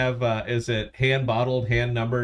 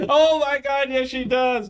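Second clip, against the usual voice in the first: amused outburst. Real, not acted. Host reacting to co-host's tasting, real laughing outburst. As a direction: crack up and crow in a high voice.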